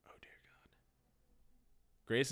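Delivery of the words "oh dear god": barely audible whispered dread; hushed, stunned mock prayer; horrified whisper. Dread after crashing the car.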